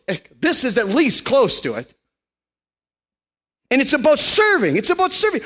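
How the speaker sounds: high, loud impassioned sermon; fiery preaching. Acted. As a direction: preach it with full fervour. Preaching at full force.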